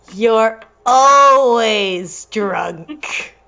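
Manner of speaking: very slow, each word drawn out; read slowly. Shown as a demonstration. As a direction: drag out every word. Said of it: "oh, I gotta read this slowly".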